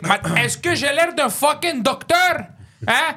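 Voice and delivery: hammed-up outrage in French; colère jouée et lente; mock angry French waiter. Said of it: "He's like".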